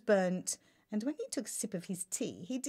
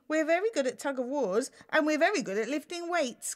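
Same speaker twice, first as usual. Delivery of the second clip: complaining; 7 semitones higher, 6 dB louder than usual. Acted. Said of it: "complained one of the giants".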